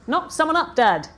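put-on teenage sister announcing it loudly to the room; repeated loudly. A narrator's reading. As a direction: shout it across the room to a deaf dad. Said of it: "she repeated loudly".

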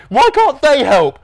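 high, loud, drawn-out protest; venomous whine. A narrator's reading. Norm complaining about younger siblings, resentful. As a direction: wail with resentment at your siblings. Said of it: "staring venomously in the direction".

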